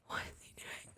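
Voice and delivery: anxious whispered thought. Retold or imitated. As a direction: whisper it to yourself, worried.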